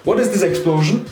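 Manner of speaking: a mild startled shout; startled yell. Retold or imitated. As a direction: blurt it out startled. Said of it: "and he's like".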